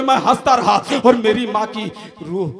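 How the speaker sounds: ordinary talk, nothing marked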